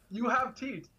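put-on a sassy Jamaican nurse's line, lightly accented. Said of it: "thick Jamaican accent".

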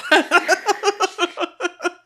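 real laughter breaking out high and loud. Real, not acted. Laughing at own joke.

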